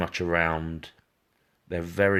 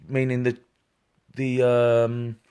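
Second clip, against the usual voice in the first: ordinary talk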